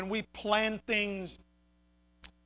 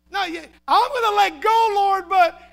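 high, loud comic protest; hammed-up doubtful plea; mock hedging; 9 semitones higher, 6 dB louder than usual. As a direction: stall nervously while bargaining with the Lord. Acts out us bargaining with God.

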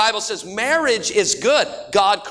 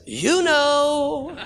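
higher, knowing parrot voice; sly parrot; 6 semitones higher than usual. Acted. Parrot teasing the woman, higher and sly. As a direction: let the parrot hint without saying it. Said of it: "he says".

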